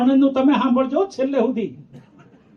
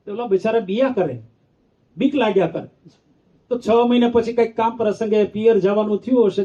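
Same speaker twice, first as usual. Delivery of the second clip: normal talking voice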